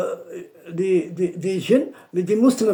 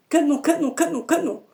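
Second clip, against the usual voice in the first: high, eager repeated question; 9 semitones higher than usual. Retold or imitated. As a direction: ask eagerly, higher up. Djinn eagerly asks name. (question aiguë et impatiente)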